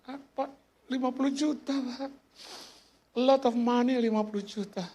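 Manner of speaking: high imitated pleading voice; meniru orang memelas. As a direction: raise the voice high as if pleading over a big sum. Meniru jawaban orang soal jumlah. (suara tiruan tinggi memelas)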